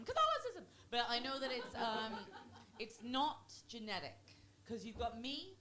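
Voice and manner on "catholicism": much higher, loud blurted cry; sudden outburst. Pretend. Anxious comedian blaming where anxiety comes from, sudden high outburst. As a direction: blurt it out like an involuntary shriek.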